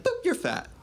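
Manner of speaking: very high, chirpy beep-voice insults; cutesy robot insults. Retold or imitated. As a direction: boop like a cheerful glitching bot. Chatbot spits insults with beeps.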